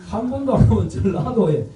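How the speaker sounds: usual speaking voice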